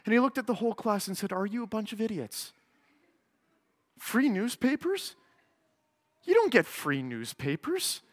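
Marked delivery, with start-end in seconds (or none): 1.3-8.0 sudden calm. Retold or imitated. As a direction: drop to a quiet, pointed calm. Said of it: "pure calmness".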